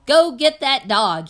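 loud, slow exasperated yell. A narrator's reading. Preacher yelling at Opal to fetch the dog. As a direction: bellow it across the church. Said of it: "he yelled".

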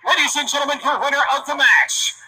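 big ring announcer winner call; booming pumped announcer. Acted.

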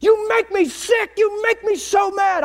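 yelling in anger, pitched high; retold furious yell. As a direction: relive a teenage rage at the Bible. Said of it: "I yelled at it like it was somebody I hated".

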